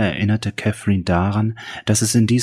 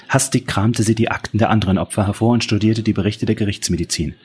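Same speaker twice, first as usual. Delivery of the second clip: usual speaking voice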